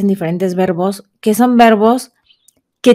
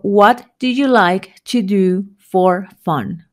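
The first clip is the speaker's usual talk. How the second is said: slow teacher model; slower than usual. Shown as a demonstration. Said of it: "a manera de ejemplo".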